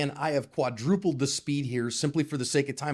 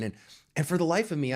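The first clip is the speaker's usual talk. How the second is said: ordinary delivery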